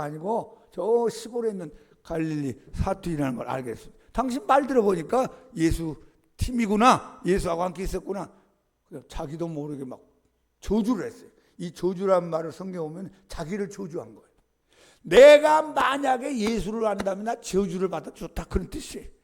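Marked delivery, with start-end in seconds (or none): 4.1-8.3 expose him triumphantly; accusing bystander. Retold or imitated. Bystander catching Peter's Galilean accent, louder accusation. 15.0-18.5 loud, higher self-cursing oath; desperate oath. Retold or imitated. Peter cursing himself before the crowd, loud oath.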